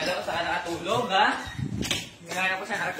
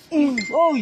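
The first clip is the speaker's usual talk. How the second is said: shouting; 6 semitones higher, 7 dB louder than usual. Real, not acted. Waking Linda with a shout.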